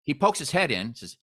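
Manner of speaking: ordinary talk, nothing marked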